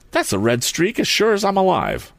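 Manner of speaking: high-pitched exclamation of discovery; astonished, pitched well up; excited cry. A narrator's reading. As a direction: cry out as you recognize it. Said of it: "he cried".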